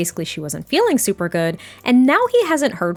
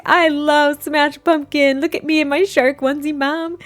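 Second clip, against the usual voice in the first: put-on high, gleeful little-child voice; happy baby; 6 semitones higher than usual. Baby to Mom about smashed pumpkin, gleeful child voice. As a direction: play a thrilled baby showing off to Mom. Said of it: "He's just like".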